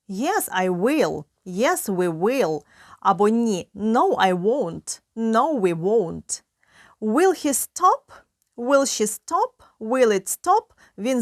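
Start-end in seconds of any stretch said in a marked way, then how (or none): none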